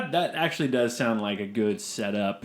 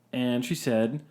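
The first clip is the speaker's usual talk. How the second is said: ordinary delivery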